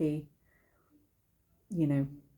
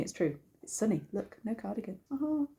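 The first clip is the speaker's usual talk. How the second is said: normal talking voice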